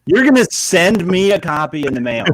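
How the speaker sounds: incredulous. Acted. Mocking friend's gift plan.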